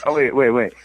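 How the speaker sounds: normal talking voice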